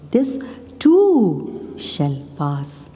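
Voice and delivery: "too" drawn out very long; slow line with all the weight on "too"; stress on TOO. Shown as a demonstration. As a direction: stretch out "too", then slowly let "shall pass" drop. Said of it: "emphasis on each word".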